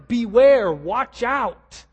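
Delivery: loud, punched "Beware"; emphatic warning. Acted. Preacher repeats Paul's threefold warning, very emphatic. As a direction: hit "Beware" hard, then "watch out". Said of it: "He's very emphatic here".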